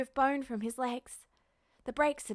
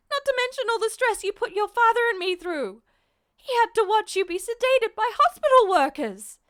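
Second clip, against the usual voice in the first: high, loud, livid scolding; furious mother; 10 semitones higher, 9 dB louder than usual. Acted. Mother scolding her son for fleeing the hospital, furious. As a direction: tear into him like a frightened, furious mom.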